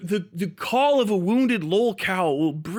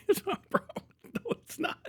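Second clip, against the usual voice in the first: whispered denial; 11 semitones higher, 9 dB quieter than usual. Real, not acted. Laughing denial to himself.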